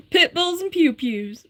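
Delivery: put-on slow, high Southern accent through laughter; laughing Southern accent. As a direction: savor it in a hammed Southern voice. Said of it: "in a Southern accent".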